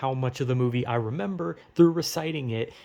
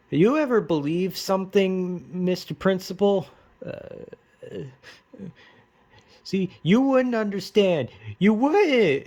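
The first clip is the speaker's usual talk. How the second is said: higher and building to a loud yell; dramatic yelling teen; 6 semitones higher, 4 dB louder than usual. Retold or imitated. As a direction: overdramatic teen screaming, louder and louder. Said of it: "screams at the principal".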